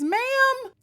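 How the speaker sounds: high drawn-out sassy ma'am. Real, not acted. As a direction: call her out, drawn out. Reaction creator addressing the mom.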